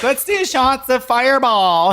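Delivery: high, loud, drawn-out party yell; rowdy twenty-one-year-old. Retold or imitated. Young drinkers to the bar, mock rowdy holler. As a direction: whoop it out like a kid egging on the bar. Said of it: "twenty-one-year-olds who are like".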